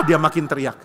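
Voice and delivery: ordinary talk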